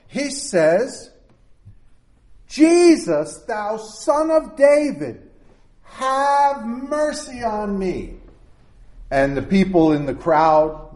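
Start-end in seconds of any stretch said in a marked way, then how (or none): 2.5-8.1 high, pleading cry for mercy; howling plea. A narrator's reading. Blind beggar crying out to Jesus, high pleading howl. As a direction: call out to him, high and begging. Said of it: "He starts to howl and to yell".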